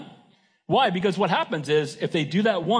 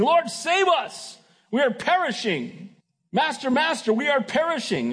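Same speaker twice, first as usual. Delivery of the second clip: much higher, strained cry of frightened men; panicked cry for help; 8 semitones higher than usual. Acted. Disciples waking Jesus in the storm, a higher panicked cry. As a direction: plead as if the boat is sinking.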